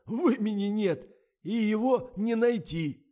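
high, loud, slow gleeful shout. A narrator's reading. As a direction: crow over the joke. Cameraman laughing at discovery.

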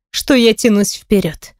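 usual speaking voice

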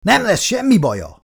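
loud, high forceful shout; roaring. A narrator's reading. Father roars at his struggling daughter to calm her. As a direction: bellow it to stop her. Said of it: "ordította Chris".

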